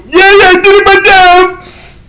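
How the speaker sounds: very high, put-on triumphant cry; proud announcement. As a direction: crow it in a high acted voice. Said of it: "he was all proud".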